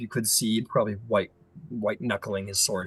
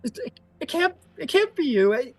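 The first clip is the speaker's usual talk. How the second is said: very high, stammering voice; trembling victim, halting and high; frightened disbelief; 3 dB louder than usual. Acted. Terrified woman denies recognizing the Prince.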